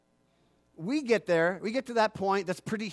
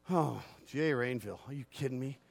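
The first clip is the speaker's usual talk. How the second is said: annoyed groan; 6 semitones lower, 7 dB quieter than usual. Retold or imitated. Mocking annoyed caller.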